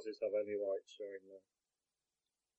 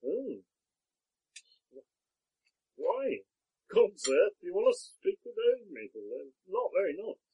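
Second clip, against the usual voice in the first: put-on higher-pitched wary old man; a farmer probing with suspicion; Podrick, suspicious farmer; 11 semitones higher than usual. GM voices Podrick.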